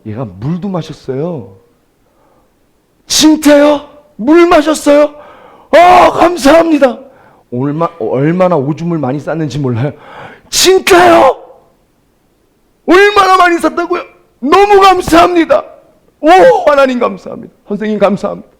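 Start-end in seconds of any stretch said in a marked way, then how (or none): 3.1-7.0 high, loud and slow at the end; overjoyed. Retold or imitated. Parent hearing the baby drank water, overjoyed. 10.5-18.5 overjoyed. Retold or imitated. Parent hearing the baby drank water, overjoyed.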